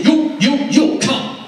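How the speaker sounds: loud, high soldier's command; barked order. Retold or imitated. Soldiers ordering a civilian away, loud bark. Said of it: "they will come and they say".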